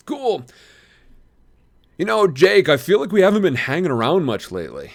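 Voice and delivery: laying on the buddy act; chummy brother. A narrator's reading. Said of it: "Tom nodded and stood up".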